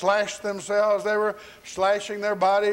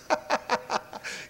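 high breathy cackle; acted high, wheezy laugh; mocking cackle; 10 semitones higher, 6 dB quieter than usual. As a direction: snicker at their failure. Elijah laughs at the prophets.

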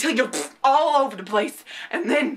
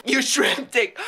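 normal talking voice

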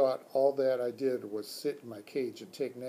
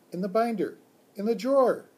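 high and louder, insistent squeak; urgent hamster squeak; 8 semitones higher, 8 dB louder than usual. Acted. Hamster directs teacher to the drawer, loud high squeak. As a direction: squeak it out, urging him on. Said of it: "I squeaked".

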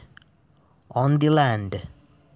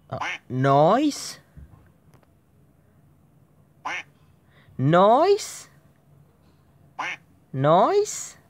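rising, louder disbelieving calls; alarmed noise; 8 semitones higher, 6 dB louder, much slower than usual. Real, not acted. Gamer startled by a noise, calls out alarmed. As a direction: call out higher each time.

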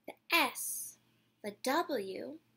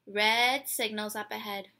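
ordinary delivery, nothing marked

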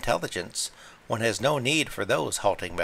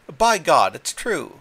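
loud, high burst of shock; agitated exclamation; 7 semitones higher, 9 dB louder, slower than usual. A narrator's reading. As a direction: blurt it out, shaken. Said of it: "agitation was unmistakably genuine".

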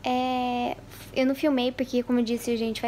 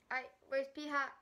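ordinary talk

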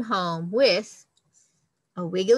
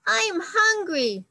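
high, louder whiny plea; hungry baby bird; 10 semitones higher, 4 dB louder, much slower than usual. Acted. Confetti begging the giraffe, high whiny plea. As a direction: squeak it up high like a starving chick.

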